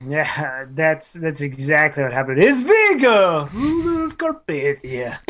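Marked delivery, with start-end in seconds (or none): 2.4-5.2 high, drawn-out villain proclamation; Vigo. Acted. Fan quoting a movie villain to co-host, high hammed-up proclamation. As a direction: announce it slowly like a hammed-up movie tyrant.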